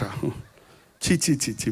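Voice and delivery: soft, low disgusted చి చి; స్వరం తగ్గించి అసహ్యంగా చి చి; disgusted chi chi. Real, not acted. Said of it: "బూతుల భాష". (అసహ్యంతో మెల్లగా చి చి)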